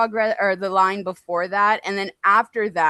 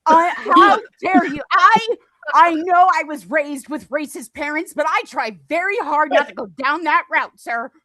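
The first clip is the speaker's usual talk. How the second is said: mock outrage; 6 semitones higher, 6 dB louder than usual.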